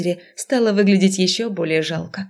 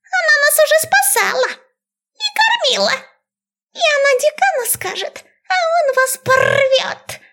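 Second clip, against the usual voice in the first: squeaky rat voice. A narrator's reading. Rats boast about the dean.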